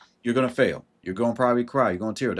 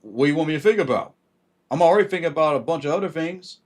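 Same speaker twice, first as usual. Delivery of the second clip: a higher, louder, fast and fed-up voice; exasperated Virgo; 5 semitones higher, 6 dB louder than usual. Retold or imitated. A Virgo snapping back at advice to think before acting. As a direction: snap back like an overloaded Virgo. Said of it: "be like".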